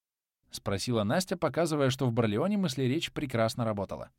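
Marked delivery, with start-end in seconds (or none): none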